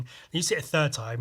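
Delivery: usual speaking voice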